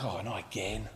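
very low, soft groaned aside; quiet, sinking exasperation; weary dismay. Acted. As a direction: sigh it under your breath. Weary disciple sees another crowd.

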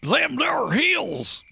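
high, drawn-out prospector voice; hammed-up old-West miner, slow and high-pitched. Shown as a demonstration. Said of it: "do the fucking miner voice".

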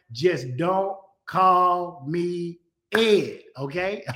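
very slow, each word laid out; slow deliberate warning. Real, not acted. Pastor to viewers, slow and deliberate. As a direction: drag it out so it sticks.

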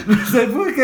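high laughing words. Real, not acted. Laughing at her childhood fear of being arrested. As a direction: laugh through the line.

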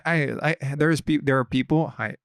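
ordinary talk, nothing marked

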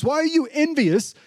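high, outraged "Why are you envious?"; indignant landowner. Acted. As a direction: throw the question back, offended, pitched high. Landowner confronting grumbling workers.

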